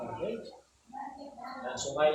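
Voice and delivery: normal talking voice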